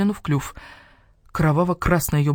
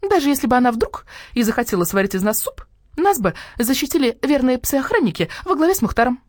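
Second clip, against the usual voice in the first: heated hen voice, louder; indignant hen; 7 semitones higher, 4 dB louder than usual. Acted. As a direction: defend Nina hotly. (возмущённая курица)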